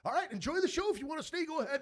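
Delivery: high, airy impression of the rock star; impression of the band guy. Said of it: "I pull one out".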